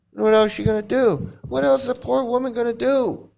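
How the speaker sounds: high, sarcastic sympathy; mock pity. Real, not acted.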